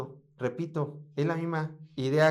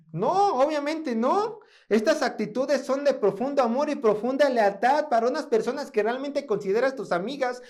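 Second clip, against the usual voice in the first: raised, emphatic sermon voice; fervent preaching; 7 semitones higher, 3 dB louder than usual. Acted. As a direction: challenge the congregation passionately. Preaching challenge to congregation.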